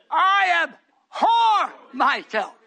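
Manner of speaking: slow, loud, breaking cry of abhor. Retold or imitated. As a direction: wail abhor like Job. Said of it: "he cried out".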